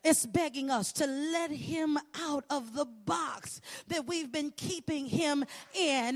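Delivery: ordinary talk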